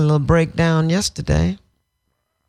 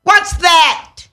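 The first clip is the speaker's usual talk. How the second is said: higher, louder, aggrieved demand; retold railing at God; 11 semitones higher, 4 dB louder than usual. Grieving daughter confronting God about the con artist, aggrieved. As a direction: shake your fist at heaven.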